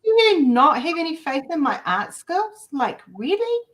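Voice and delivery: high, loud mock indignation ending in laugh; mock offended. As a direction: pretend to be insulted, then laugh. Mock offended about art skills.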